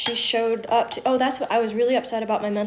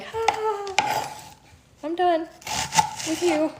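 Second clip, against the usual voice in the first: fake polite laugh; 8 semitones higher, slower than usual. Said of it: "but you're like".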